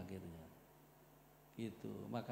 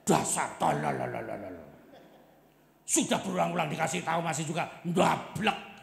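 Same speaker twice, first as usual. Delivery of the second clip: louder, contemptuous rant; mock insult; 3 semitones higher, 9 dB louder than usual. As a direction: spit out an angry online jab. Angry status post voiced.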